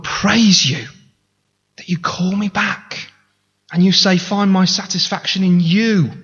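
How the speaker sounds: heartfelt prayer of confession; confessing prayer. Acted. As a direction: pray it aloud as a humble confession.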